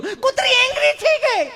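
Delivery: hammed-up frightened woman; mock scared lady. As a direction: wail that the dog got angry. Lady complains dog angry.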